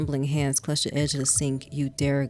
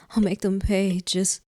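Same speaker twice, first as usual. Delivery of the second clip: tearful pleading; 5 semitones higher than usual. A narrator's reading. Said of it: "your cries".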